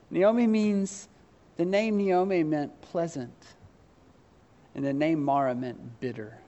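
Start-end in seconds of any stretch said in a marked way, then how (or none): none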